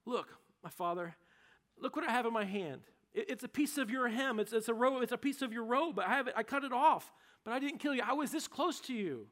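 earnest plea. Acted. David pleads with Saul.

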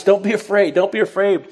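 excited angel blurting reassurance; eager angel. Acted. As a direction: rush to calm a terrified man. Angel reassures frightened Zechariah.

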